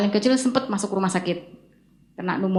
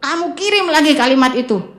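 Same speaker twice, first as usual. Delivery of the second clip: higher, firm retold order; insistent; 6 semitones higher than usual. Mentor telling her to send it again, retold insistence. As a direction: imitate someone refusing to budge. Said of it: "Ibu Eli kekeh".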